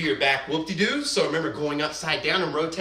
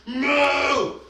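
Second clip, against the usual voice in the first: a louder shouted moo; loud cow moo; 6 semitones higher, 4 dB louder than usual. Pretend. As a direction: belt out a big cow moo. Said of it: "we mooed like a cow".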